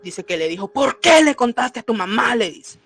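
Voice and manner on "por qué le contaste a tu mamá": loud, raised-pitch angry demand; retold scolding, voice pushed up and out; harsh scolding. The duende confronts the boy for telling his mother, harsh scolding. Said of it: "me habló feo".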